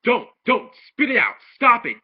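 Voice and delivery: high, loud frantic yelling; mock panicked father shouting; frenzied rant. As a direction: yell it in a panic like a frantic dad. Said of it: "just in a frenzy. He's in a rant".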